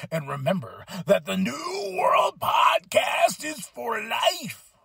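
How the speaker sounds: slow, shouted wrestler-promo tag line; catchphrase sign-off. Acted. Host signing off the podcast with a slow promo shout. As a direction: bellow it like a ring announcer.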